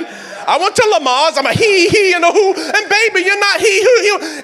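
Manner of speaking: high, laughing mock breathing chant; laughing Lamaze mimicry. Pastor laughing while mocking his Lamaze class. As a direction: giggle through the hee hee hoo.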